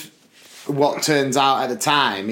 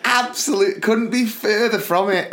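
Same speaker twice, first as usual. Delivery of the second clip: incredulous; 7 semitones higher than usual. Retold or imitated. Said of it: "I said".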